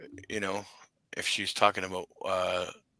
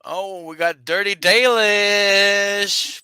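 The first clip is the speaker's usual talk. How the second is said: loud, slow, higher announcer call; announcer hype; 8 semitones higher, 13 dB louder, slower than usual. Pretend. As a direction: welcome them like a hype announcer. Hyping returning guest.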